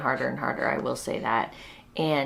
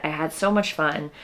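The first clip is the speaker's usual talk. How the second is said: normal talking voice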